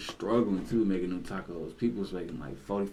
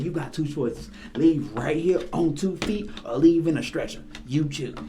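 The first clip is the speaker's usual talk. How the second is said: retold threat; 6 semitones higher, 4 dB louder than usual. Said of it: "he said".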